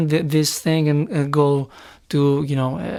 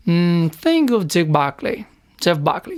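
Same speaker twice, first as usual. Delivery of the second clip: ordinary delivery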